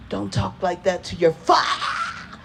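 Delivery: slow rebuke breaking off in a yelp; cut-off scolding. Retold or imitated. Dad scolding daughter as he's grabbed, cut-off scolding. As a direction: imitate a stern dad, stretching the last syllable until cut short.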